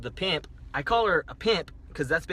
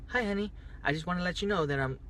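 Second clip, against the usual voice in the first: higher, polite receptionist-like phone voice; office voice; 4 semitones higher, 4 dB quieter than usual. Retold or imitated. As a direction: imitate her switching into office mode. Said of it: "switched over to her office voice".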